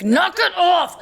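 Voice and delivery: high, loud snapping scold; sharp scold. Retold or imitated. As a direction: snap it at the child. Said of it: "things that just come out of my mouth".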